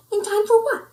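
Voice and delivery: high, loud puzzled question; surprised question. Acted. Pup asking his friends, high puzzled. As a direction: ask in surprise.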